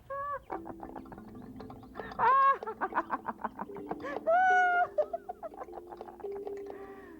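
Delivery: very high, eerie drawn-out laughter; haunted forest laughing at the girl; trees laughing. Acted. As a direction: laugh like spooky trees in the dark. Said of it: "the trees began to laugh".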